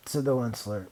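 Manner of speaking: ordinary delivery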